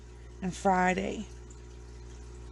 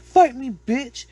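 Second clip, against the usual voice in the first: loud, higher taunting shout; mock aggressive challenge; 6 semitones higher, 9 dB louder, slower than usual. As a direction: call the demons out for a fight. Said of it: "he tries to fight the ghosts".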